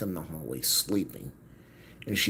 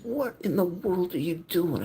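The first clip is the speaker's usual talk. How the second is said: much higher and louder, incredulous; exasperated demand; 14 semitones higher, 5 dB louder than usual. Retold or imitated. Son confronting mother at the kitchen table, loud exasperated demand. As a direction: confront her loudly in disbelief. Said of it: "I said".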